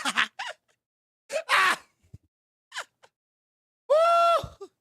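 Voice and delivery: imitated shrieking laughter, very high; piercing mock giggle-squeal; high mock laughing squeal. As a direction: whoop a high, hammed-up laugh. Acting out her laughter in bed.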